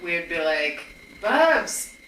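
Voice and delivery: ordinary talk, nothing marked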